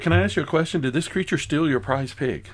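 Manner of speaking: ordinary talk, nothing marked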